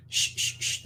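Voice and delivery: unvoiced soft shush. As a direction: hush the toddler gently. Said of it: "They go".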